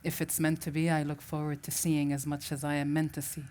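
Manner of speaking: normal talking voice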